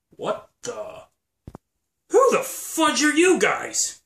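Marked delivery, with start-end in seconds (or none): 2.1-4.0 loud, high, slow startled demand; surprised shout. Acted. Finding two strangers hiding, startled and loud.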